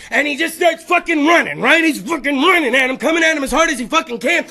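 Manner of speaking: ordinary talk, nothing marked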